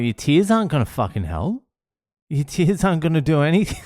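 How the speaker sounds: fast, high-pitched impatient scolding; retold yelling. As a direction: rattle off a dad's exasperated rebuke. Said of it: "and I'm yelling".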